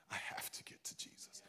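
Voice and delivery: voiceless urgent whisper. Acted. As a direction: breathe it out as a whisper. Whispered take of same words.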